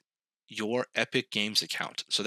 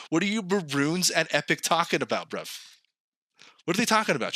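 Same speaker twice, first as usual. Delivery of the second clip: ordinary delivery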